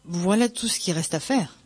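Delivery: ordinary delivery, nothing marked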